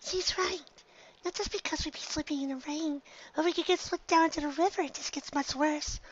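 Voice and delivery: a shy pony almost too quiet to hear; soft, high, meek worry; barely audible. Acted. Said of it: "her voice barely audible above the rain".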